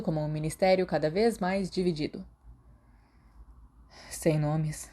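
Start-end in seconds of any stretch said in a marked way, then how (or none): none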